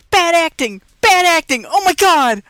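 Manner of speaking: high, loud cries of dismay; loud outcry. Real, not acted. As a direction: shout it out high, appalled by what you're watching. Exclaiming at what she is watching.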